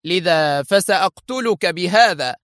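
mock him with cool contempt; a lightly voiced sneering enemy agent; sarcastic villain. A narrator's reading. Eli threatens.

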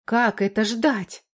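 indignant question, stretched out; exclaiming. A narrator's reading. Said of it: "воскликнула Валька".

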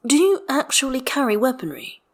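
thoughtful. A narrator's reading. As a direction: ask it as if weighing the idea. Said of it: "Astarion says thoughtfully".

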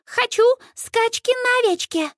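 put-on very high little-girl voice; squeaky, insistent child's demand; stubborn toddler yell. A narrator's reading. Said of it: "упрямо орёт вдруг Минни".

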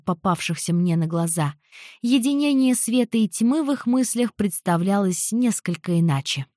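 ordinary delivery, nothing marked

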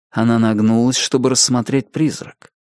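usual speaking voice

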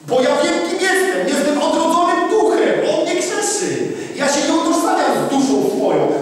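high, loud proclaiming; fiery preaching. Acted. Preacher retells answering his accuser, fiery preaching. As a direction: shout your identity as a reborn spirit.

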